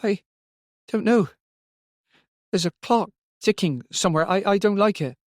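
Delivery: put-on high, hesitant, frightened child's voice; small child upset and afraid; whimpering child. A narrator's reading. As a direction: whimper it in halting phrases. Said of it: "She began to whimper".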